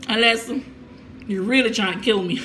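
normal talking voice

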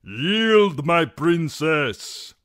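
high, loud, drawn-out command; slow ringing shout; captain calling. A narrator's reading. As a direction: call out a formal demand to surrender. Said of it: "the captain called".

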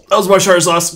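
loud frustrated yell; angry. Real, not acted. Angry at a game death.

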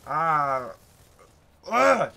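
two loud bursts of "ah"; loud exasperated groan. Real, not acted. Hay-fever sufferer with itchy nose, loud exasperated groan.

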